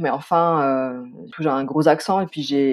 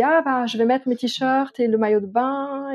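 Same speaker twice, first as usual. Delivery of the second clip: slow, higher, offhand voice; husband's casual line; 8 semitones higher than usual. Retold or imitated. Wife imitating her husband packing too late, slow and offhand. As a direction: play the unhurried husband. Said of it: "il me dit".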